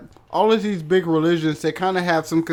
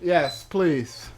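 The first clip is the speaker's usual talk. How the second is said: normal talking voice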